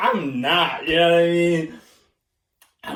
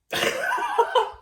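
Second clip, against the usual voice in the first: airy laugh. Real, not acted. Laughing before punchline.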